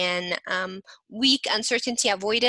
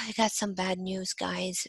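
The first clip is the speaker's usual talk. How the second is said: sigh, then softer, subdued delivery; sad low tone; 2 semitones lower, 4 dB quieter than usual. Shown as a demonstration. Teacher acting out giving class bad news, sad low tone. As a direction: demonstrate breaking bad news sadly. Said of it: "low tone when you're sad".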